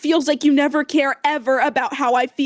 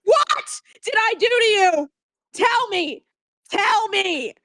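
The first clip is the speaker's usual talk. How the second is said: sobbing, furious demands; crying shout. Real, not acted. Girlfriend demanding answers, shouting and crying. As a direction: yell it through tears.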